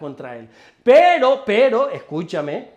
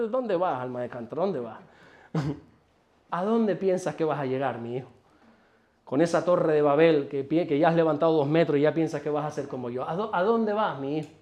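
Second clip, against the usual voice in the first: lightly voiced God, softer and lower, chuckling at a rebel; amused God teasing; 7 semitones lower, 7 dB quieter than usual. God laughing from heaven at a rebel, soft amused teasing. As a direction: tease the wayward child gently, with a laugh under it. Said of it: "él se ríe en el cielo como diciendo".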